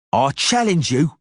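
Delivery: high sneering taunt, mockery rising; Cicada jeering. Cicada mocking the witcher about his woman, sneering high taunt. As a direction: needle him until he draws. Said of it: "narrator voicing Cicada".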